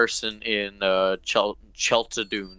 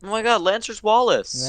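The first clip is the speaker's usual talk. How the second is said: high-pitched amazed exclamation; mock astonishment; 10 semitones higher than usual. Real, not acted. Teasing co-host about the impression, mock astonished. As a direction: announce a shocking discovery in a raised voice.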